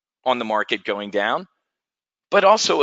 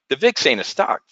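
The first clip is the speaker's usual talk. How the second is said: ordinary talk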